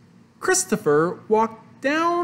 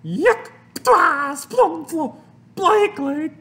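high, loud, spluttering disgust; disgusted yell; 10 semitones higher, 6 dB louder, slower than usual. A narrator's reading. Mother bites into play clay, disgusted yell. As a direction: yell and spit out each sound. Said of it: "And she yelled".

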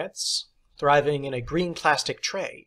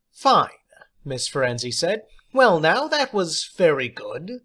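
high, louder teacher's voice; theatrical teacher; 4 semitones higher, 4 dB louder than usual. Acted. Substitute teacher praising John, high and theatrical. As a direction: play the odd substitute, grand and pleased.

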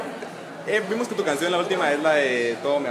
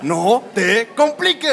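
slow, higher caveman grunt; 6 semitones higher, 3 dB louder, much slower than usual. Shown as a demonstration. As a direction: drag it out like a caveman. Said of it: "con voz de cavernícola". (voz de cavernícola)

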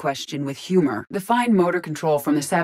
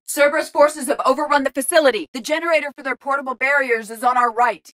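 high-pitched tactical call-out; urgent battle report; 8 semitones higher than usual. Acted. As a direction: direct the squad under fire. Reporting enemy positions in combat.

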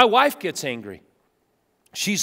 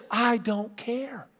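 much softer, slow, detached; cold refusal; 4 semitones higher, 7 dB quieter, slower than usual. Retold or imitated. Preacher voicing the older son coldly refusing to celebrate, soft and slow. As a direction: say it flat-out unmoved, quietly. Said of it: "The one says".

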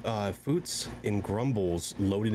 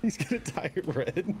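laughing. Real, not acted. Laughing at teammate's near tie.

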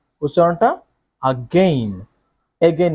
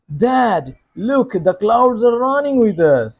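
put-on excited child; 7 semitones higher, much slower than usual. Shown as a demonstration. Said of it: "excited".

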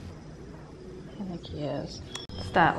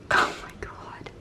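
breathy hushed gasp of love; whispered awe; 5 dB quieter than usual. Real, not acted. Fawning over the cat's face, whispered. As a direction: whisper it in delight.

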